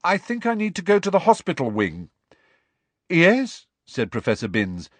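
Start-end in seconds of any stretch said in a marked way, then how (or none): 0.0-2.1 lightly voiced high schoolboy; insistent pupil, urgent. A narrator's reading. Pupil asking professor to leave class, insistently. 3.1-3.7 high, loud, startled query; wrong-footed. A narrator's reading. Professor thrown by pupil's request, wrong-footed. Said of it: "clearly very much wrong-footed".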